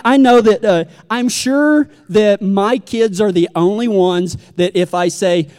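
usual speaking voice

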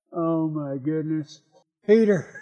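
slow, low and soft dismay; weary exasperation. Acted. Preacher reacts to a buddy like Peter, weary exasperation. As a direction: let it sink out quietly in mock despair.

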